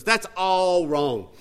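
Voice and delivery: very slow, drawn-out verdict; slow, deliberate mock correction; imitated boy's correction. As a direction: stretch each word like a child teacher. Boy corrects his attempt at writing.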